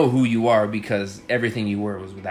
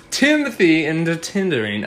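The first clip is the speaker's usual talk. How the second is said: higher, louder put-on mystery accent on the Tinder talk; an unplaceable accent he can't name himself; 6 semitones higher, 4 dB louder than usual. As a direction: slip into his odd unnamed Tinder accent, playful. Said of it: "I speak in this accent that I don't".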